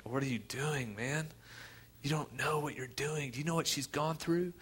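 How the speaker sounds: hushed, incredulous questioning; baffled protest. Retold or imitated. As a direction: quietly challenge a stranger's odd act. Pallbearers baffled at Jesus' interference.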